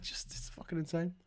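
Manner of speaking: whispered aside; hushed disbelief. Real, not acted. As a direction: drop to a whisper.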